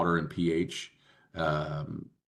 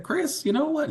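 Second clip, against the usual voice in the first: put-on high-pitched West Virginia country accent; 4 dB louder than usual. Nurse aunt talking to Chris, high West Virginia accent. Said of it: "when I do this accent".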